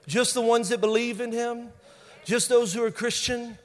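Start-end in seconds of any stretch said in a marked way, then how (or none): none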